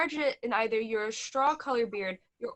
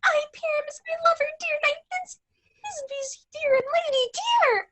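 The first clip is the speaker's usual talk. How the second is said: tiny squeaky voice, much higher; monstrous little voice; 4 dB louder than usual. Acted. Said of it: "I'll speak in a monstrous little voice".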